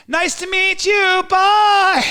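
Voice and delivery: very high, loud, drawn-out holler; drive-by yell. Retold or imitated. Driver yelling at his rival, high and loud. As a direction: holler it slowly as if driving past. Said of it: "I was in my Prius and I went".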